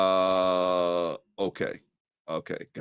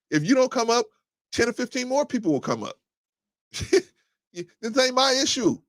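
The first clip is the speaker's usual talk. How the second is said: pitched way up, insisting it's not his issue; heated rant; 12 semitones higher than usual. Real, not acted. Live host to caller, high-pitched heated rant. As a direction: push it back on them, high and worked up.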